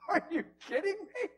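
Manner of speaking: high, loud disbelief; incredulous. Acted. Preacher scoffing at the idea he is losing, incredulous. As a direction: exclaim it high and loud, half laughing.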